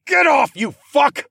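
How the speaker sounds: high, loud furious holler; full-force angry shout. Acted. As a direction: yell at an attacker while kicking out. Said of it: "I hollered".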